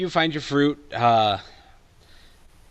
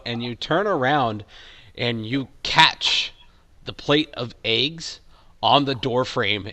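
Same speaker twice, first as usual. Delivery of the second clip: usual speaking voice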